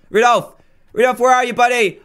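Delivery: loud, high call across the stable. Real, not acted. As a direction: shout to find a lost friend. Calling out for missing reindeer.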